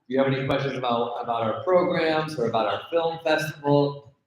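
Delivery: ordinary talk, nothing marked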